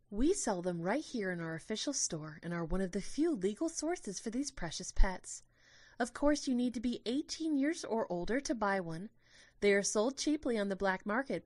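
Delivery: a hint of a cheerful tour guide; gentle tour guide. Tour guide sales talk.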